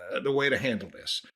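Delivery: ordinary talk, nothing marked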